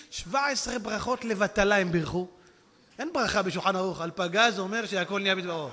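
ordinary talk, nothing marked

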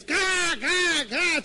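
high, loud imitation caws; harsh bird cries; crow cawing. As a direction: caw like screeching crows. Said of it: "צועקים".

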